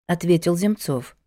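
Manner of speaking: ordinary delivery, nothing marked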